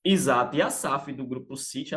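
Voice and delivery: ordinary talk, nothing marked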